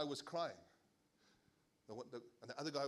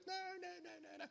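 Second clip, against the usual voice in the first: Pharaoh refusing; 6 dB quieter, much faster than usual. Retold or imitated. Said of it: "Pharaoh's like".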